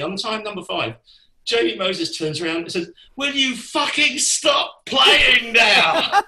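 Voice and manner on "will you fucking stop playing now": slow, loud, high shout through a laugh; laughing mock yell, drawn out; exasperated shout. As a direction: bellow it across the stage. Bandmate yells at the drummer.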